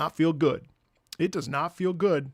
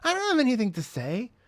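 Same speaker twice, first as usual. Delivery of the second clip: higher, louder mimicry of mom; put-on voice of a protesting mother; mom impression; 8 semitones higher, 5 dB louder than usual. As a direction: imitate mom protesting, lifting at the end. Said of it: "That's what she sounds like too".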